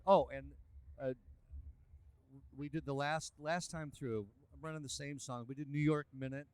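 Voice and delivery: usual speaking voice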